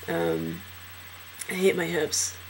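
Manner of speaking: normal talking voice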